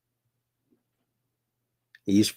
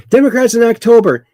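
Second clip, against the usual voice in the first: higher, fast, loud sarcastic setup; mocking announcement; 10 semitones higher, 7 dB louder, faster than usual. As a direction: announce it like a punchline, high and quick. Mocking Democrats before and after.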